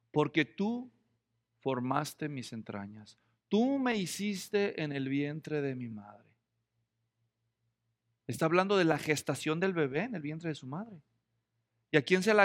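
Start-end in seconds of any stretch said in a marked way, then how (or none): 0.1-6.2 slow, softer and lower, reverent; reverent psalm reading. Retold or imitated. Preacher quoting the psalmist to the congregation, slow and reverent. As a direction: read the psalm line slowly with reverence.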